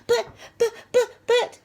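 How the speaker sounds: high, loud, stuttered protest; spluttering. A narrator's reading. Perfect Peter wrongly blamed by teacher, high spluttering. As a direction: splutter helplessly. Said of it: "spluttered Perfect Peter".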